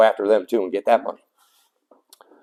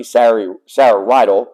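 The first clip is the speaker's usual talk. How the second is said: ordinary delivery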